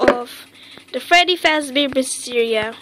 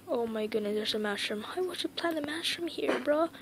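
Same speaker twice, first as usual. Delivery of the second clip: much softer, hushed voice; quiet puzzled aside; 14 dB quieter than usual. Real, not acted. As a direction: keep it down while sneaking. Quietly exploring dark room.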